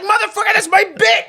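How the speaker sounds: shrill furious yell; high angry shout. Retold or imitated. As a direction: yell it like someone stole your bit. Acting out angry jumper.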